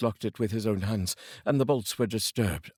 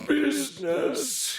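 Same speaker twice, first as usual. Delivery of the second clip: high, anguished ghost's cry; slow, pained outcry of the ghost; cried out; much slower than usual. Acted. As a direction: wail the word in despair. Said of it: "cried the ghost".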